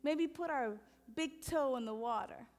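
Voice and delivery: soft, unhurried meditation guidance; gentle meditation guide; guided meditation. Acted. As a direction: ease the room into silence. Leads a meditation.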